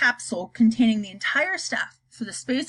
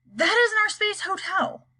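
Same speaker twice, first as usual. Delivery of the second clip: shocked astronaut; 10 semitones higher than usual. Acted.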